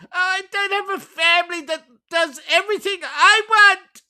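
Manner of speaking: high whining mockery; whiny mock voice. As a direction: whine it like a spoiled kid. Mocking her as whiny.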